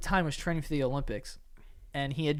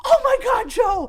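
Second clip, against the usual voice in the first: much higher, louder panicked shriek of the husband's name; mom screaming; 8 dB louder than usual. Retold or imitated. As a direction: shriek it like a frightened wife. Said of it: "my mom's like".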